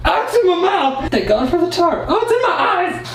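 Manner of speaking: high laughing shrieks while sprayed; squealing as foam hits the face; sprayed with water. Real, not acted.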